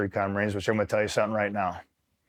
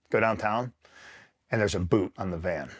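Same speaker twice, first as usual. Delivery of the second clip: ordinary talk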